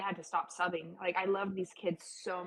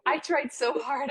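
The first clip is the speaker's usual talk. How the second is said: emphatic; 7 semitones higher, 6 dB louder, slower than usual. Real, not acted. Emphatic about missing dance.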